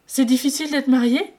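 sharply rising, loud disbelief; astonished. Acted. Wei Ying shocked that marriage is hard, loud and high. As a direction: question it in shock. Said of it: "s'étonna Wei Ying".

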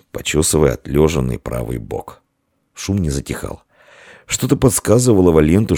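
plain reading voice

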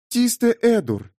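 high-pitched, lightly purred character voice; purring. A narrator's reading. Amber names the grey-skinned figure, purring. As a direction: purr it high, as a woman's line. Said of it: "мурлыкнула Янтарь".

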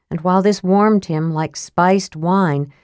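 usual speaking voice

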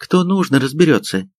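high-pitched curt dismissal; curt cutoff. A narrator's reading. Sergeant shuts down the major's protest, curt. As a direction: cut the argument short. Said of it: "отрезала старшина".

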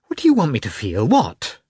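fast, rising, agitated; quick heated demand; quickly. Acted. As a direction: fire the questions fast. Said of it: "said Kitty quickly".

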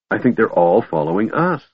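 ordinary delivery

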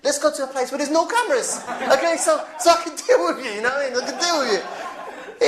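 mock threat. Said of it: "Abu Izzuddin goes".

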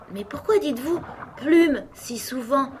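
high-pitched, mildly vexed question; a bit annoyed. A narrator's reading. Alice asking the sheep about her cry, a bit annoyed. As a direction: ask with a touch of annoyance. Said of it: "demanda Alice un peu contrariée".

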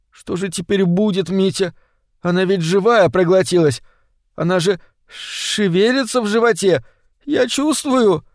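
high, plaintive whine; whimpering. Acted. Витя panics over the live fish inside him, whimpering. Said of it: "захныкал Витя".

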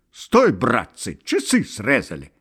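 loud, abrupt holler; loud alarmed call; sudden bark. A narrator's reading. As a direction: bark it out to stop everyone. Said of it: "вдруг гаркнул".

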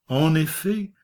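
ordinary delivery, nothing marked

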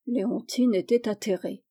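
ordinary delivery, nothing marked

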